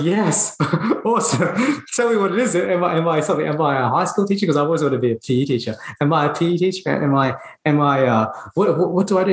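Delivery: high, laughing burst of eager questions; eager excitement. Retold or imitated. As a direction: fire off questions like a thrilled kid.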